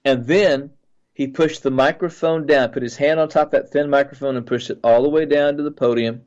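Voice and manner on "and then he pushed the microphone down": ordinary talk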